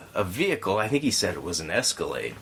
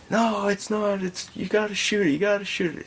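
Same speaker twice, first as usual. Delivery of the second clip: high-pitched mock insistence; irrational, frantic man; 11 semitones higher than usual. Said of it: "This guy is being completely irrational".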